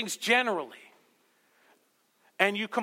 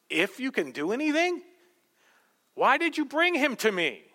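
incredulous retort; 6 semitones higher than usual. Pretend. Preacher pretending to answer the father, incredulous. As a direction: throw the words back at him. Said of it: "I would have responded that way".

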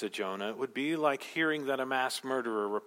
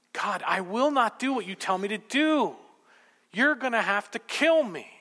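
high, louder pleading protest; mock protesting Jonah; 12 semitones higher, 4 dB louder than usual. Jonah to God, wanting to die, loud indignant protest. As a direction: push back at God like a sulking prophet.

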